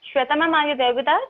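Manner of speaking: loud call into the mic; shouting into a phone. Real, not acted. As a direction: raise your voice to reach someone. Calling out to absent call participants.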